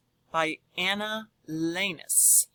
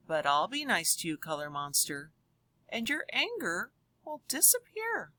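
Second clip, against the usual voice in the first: lively storybook voice for a class; reading to children. Acted. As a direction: read it aloud to the kids with big expression. Reads picture book to class.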